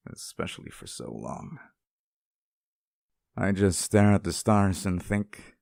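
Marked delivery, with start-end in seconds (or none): 0.1-1.6 sad. Acted. Sinks low and soft.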